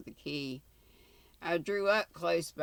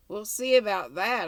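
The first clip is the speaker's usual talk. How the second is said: brisk, louder and higher retort; brisk reply; 5 semitones higher, 7 dB louder than usual. A narrator's reading. Traveller answering the reluctant guide, a brisk reply. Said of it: "I replied briskly".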